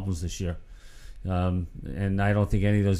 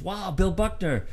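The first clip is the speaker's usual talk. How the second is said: mildly hammed-up amazement, pitched up; retold awe; 8 semitones higher than usual. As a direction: act out a fan meeting a hero. Acted fan meeting his hero.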